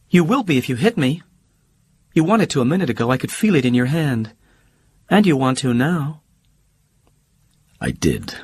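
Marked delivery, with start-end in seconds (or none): 0.1-6.2 much higher, fast, sharp-tongued socialite; Holly cutting and quick. Acted. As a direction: fire it back fast as Holly.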